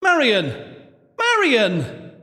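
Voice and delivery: high, urgent calls of a name; crying out. A narrator's reading. Louise to missing Marion, crying out. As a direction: cry out into the dark for someone missing. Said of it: "Louise cried out".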